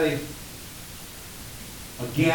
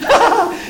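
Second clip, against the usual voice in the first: high hearty mock laugh; 8 dB louder than usual. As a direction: laugh along with the doting parent.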